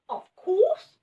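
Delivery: very high, bright little rabbit; squeaky rabbit voice. A narrator's reading. Rabbit answers the children, high and squeaky. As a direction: pitch way up for a tiny rabbit. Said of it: "said the rabbit".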